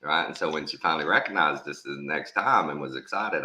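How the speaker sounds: ordinary delivery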